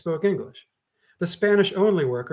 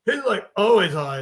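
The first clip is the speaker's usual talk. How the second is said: put-on higher, bored teen scoff; eye-rolling teen; 6 semitones higher, 5 dB louder than usual. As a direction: say it like it's obvious. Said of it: "The teen rolled her eyes".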